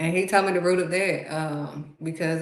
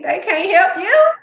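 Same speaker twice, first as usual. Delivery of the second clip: much higher, loud and crying out; God's voice; 13 semitones higher, 10 dB louder than usual. Retold or imitated. God answering her complaint, loud tearful cry. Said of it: "God's like".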